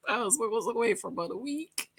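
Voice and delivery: normal talking voice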